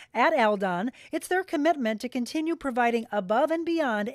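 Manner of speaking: smooth, upbeat sponsor read; radio ad read. Acted. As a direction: sell it to the listener. Reads a sponsor ad.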